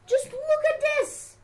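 high, incredulous shouting; exasperated outcry. Real, not acted. Player ranting at how ugly this looks, exasperated shout. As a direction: cry out in disbelief, pitched right up.

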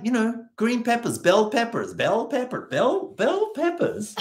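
high, explaining again and again; insistent teachers. Retold or imitated. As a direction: spell it out for the clueless Aussie, pitched high. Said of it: "They're like".

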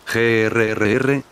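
ordinary delivery

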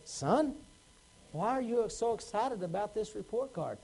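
quietly puzzled father questioning his son; stern dad. Acted. Frowning dad voiced in story.

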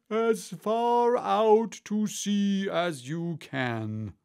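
high, loud, drawn-out call; excited shout. A narrator's reading. Gryphon telling where to throw lobsters, drawn-out shout. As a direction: hurl the words out, slowly stretched. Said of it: "shouted the Gryphon with a bound".

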